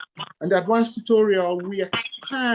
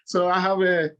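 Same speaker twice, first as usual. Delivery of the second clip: normal talking voice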